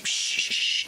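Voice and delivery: unvoiced shushing; breathy hush. Real, not acted. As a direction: shush them softly. Hushing chat.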